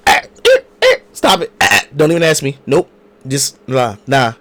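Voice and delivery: high squeal of a worker waving him off; mock squealing refusal; dismissive brush-off. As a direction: shoo him away with a shrill, loud "nah". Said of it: "hand in my face".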